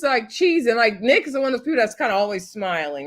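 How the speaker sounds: usual speaking voice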